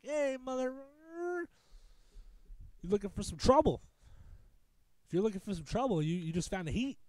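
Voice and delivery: high, cocky taunt at the batter; playing the swaggering pitcher, a touch hammed-up; cocky trash talk. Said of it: "I'm just talking like an idiot".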